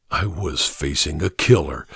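usual speaking voice

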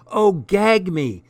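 slow, high, loud revulsion; disgusted. Acted. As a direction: drawl it out grossed out. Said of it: "she said repulsively".

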